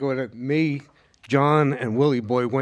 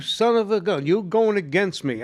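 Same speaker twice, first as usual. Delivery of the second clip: mock angry friend; 7 semitones higher than usual. Friends cursing him for winning against them, mock scolding, higher. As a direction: throw it at him like a sore loser.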